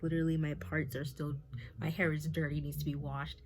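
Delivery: ordinary talk, nothing marked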